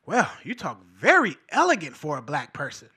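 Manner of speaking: low-pitched impressed onlooker parody. Retold or imitated.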